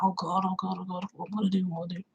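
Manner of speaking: retold panic, dropping almost to nothing; mock panic. Father panics at the dog.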